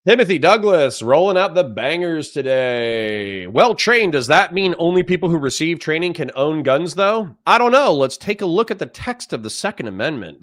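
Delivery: mock-breezy, high swooping pitch; smartass condescension. Real, not acted. Streamer answering a chatter about the Second Amendment, smartass. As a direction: talk down to the viewer with exaggerated lilt. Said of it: "I'm being a smartass and I'm being condescending".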